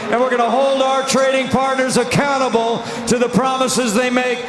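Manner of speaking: rally speech. Real, not acted. Rally promises shouted.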